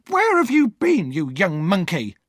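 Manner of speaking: put-on high, loud scolding cry at the boy; older sister yelling at the boy who came home late, high and loud; Missus Joe shouting. A narrator's reading. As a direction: screech it at the runaway child. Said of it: "Missus Joe cried".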